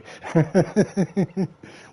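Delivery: a real burst of loud laughter, high. Real, not acted. Laughing at his mate's quote.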